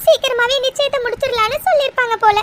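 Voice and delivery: high-pitched frightened crying; tearful fear. Acted. Girlfriend dreads the engagement her parents want, scared and crying. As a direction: sob the news out, pitched high. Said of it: "இப்படி பயப்படுற".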